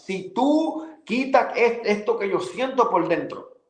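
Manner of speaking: desperate prayer. Retold or imitated. Challenging God to change him, pleading. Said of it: "Y yo comienzo a decirle a Él".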